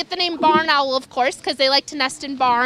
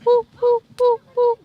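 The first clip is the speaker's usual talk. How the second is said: higher, quick beeping hoos; saw-whet owl beeps; 6 semitones higher than usual. Shown as a demonstration. Showing kids the owl that beeps like a truck. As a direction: beep like a truck backing up. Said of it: "sound like a car backing up".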